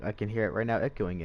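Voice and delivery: ordinary talk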